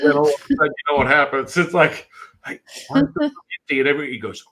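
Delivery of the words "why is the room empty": very high retold question; mock bewildered alarm. Voicing the man finding nobody there, mock bewildered alarm. Acting out the man's puzzled alarm.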